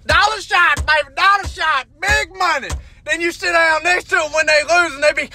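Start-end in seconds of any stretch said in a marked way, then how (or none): 0.1-2.7 imitated slot player's cheer; mock gambler's call. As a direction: call for the win like a hopeful gambler. Acted gambler calling at slots.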